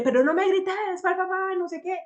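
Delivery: mock shrill scolding. Parodying the defensive reply to someone yelling, shrill and heated. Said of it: "que el solo hecho de decirle".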